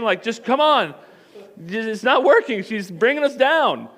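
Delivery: loud, high complaint; exasperated angel. Acted. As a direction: whine loudly for help, played for laughs. Exasperated angels calling for Jesus.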